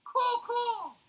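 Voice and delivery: high, loud cawing; raven caw again. Acted. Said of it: "said the raven".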